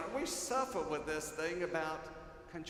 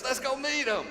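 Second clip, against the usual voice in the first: higher, louder excited invitation; eager call; 9 semitones higher, 7 dB louder than usual. Retold or imitated. Preacher echoing the child's call to greet newcomers, excited. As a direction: cheer the congregation on like a child.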